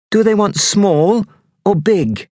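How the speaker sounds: put-on high, curious little boy; younger boy asking. A narrator's reading. Kit asking his friend about the hearts, curious. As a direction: ask it like a wide-eyed kid.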